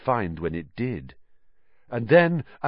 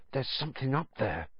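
hoarse; 4 semitones higher, 7 dB quieter, faster than usual. A narrator's reading. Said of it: "said Ron hoarsely".

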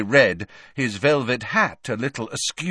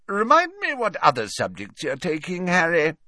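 higher, genial question; friendly host's query; Slughorn asking; 9 semitones higher than usual. A narrator's reading. Slughorn asking Harry his subjects, genial and higher. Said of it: "asked Slughorn".

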